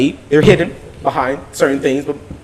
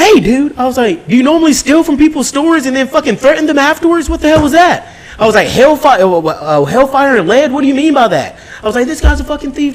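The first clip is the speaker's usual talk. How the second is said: pitched-up incredulous anger; high retold confrontation; 10 semitones higher than usual. Confronting the thief outside the store, incredulous. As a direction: call him out in disbelief.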